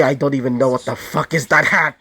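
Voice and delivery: ordinary delivery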